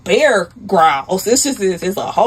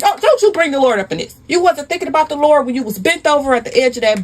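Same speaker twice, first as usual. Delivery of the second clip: higher-pitched acted scolding; indignant rebuke; 4 semitones higher than usual. Wife rebuking her cheating husband, indignant. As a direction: scold in rolling, repeated accusations.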